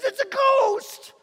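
high, loud terrified yell; frightened cry. Acted. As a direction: scream it out in sudden fear. Terrified disciple cries out.

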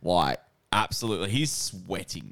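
ordinary delivery

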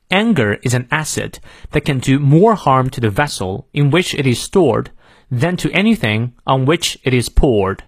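careful American teacher's read-aloud; American pronunciation. Shown as a demonstration. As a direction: clear American model reading with sounded r's. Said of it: "美式发音我们来过两遍".

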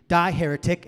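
sudden loud condemnation; mock outrage. Rescuer turning on the man over women teaching, mock outrage. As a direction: burst out in hammed-up fury.

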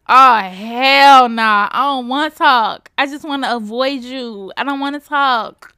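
exaggerated whiny-loud objection to an ex; emphatic refusal. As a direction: dig in and refuse, hammed up. Retelling refusal to talk to ex.

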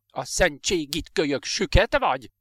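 loud exasperated outburst, rising in pitch; raging. A narrator's reading. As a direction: rage at the child. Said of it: "tombolt mister Phillips".

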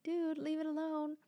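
high, exasperated plea; retold exasperation. Retelling her reply to uncle.

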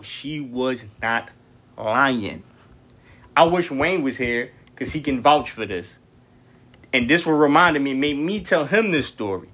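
usual speaking voice

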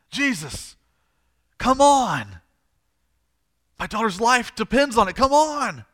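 loud, high exasperated plea; frustrated pleading. Acted. Father whose daughter's life depends on it, frustrated begging. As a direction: build to an urgent, insistent cry. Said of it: "I think he's frustrated".

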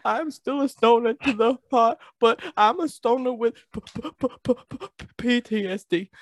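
ordinary talk

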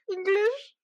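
very high, tentative squeak of a reply; timid question. Retold or imitated. Panicking newcomer answering station staff, timid question. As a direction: imitate a panicked, unsure answer going right up. Panicking, asked which language she speaks.

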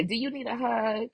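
normal talking voice

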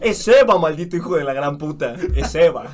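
loud playful cursing; mock furious shout. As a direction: shout it out in fake rage. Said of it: "mock rant at bullies".

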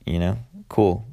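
ordinary talk, nothing marked

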